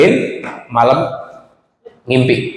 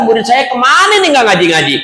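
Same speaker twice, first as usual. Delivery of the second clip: higher-pitched anxious fretting; worried teacher; 7 semitones higher than usual. Acted. Teacher worrying about absent pupils, high and anxious. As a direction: fret aloud about missing pupils.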